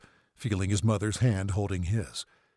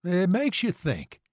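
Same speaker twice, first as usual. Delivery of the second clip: lightly voiced dazed patient, higher pitch; a touch loopy on painkillers, pitched up; drugged burn patient; 10 semitones higher, slower than usual. A narrator's reading. Drugged patient chatting up the nurse, dazed.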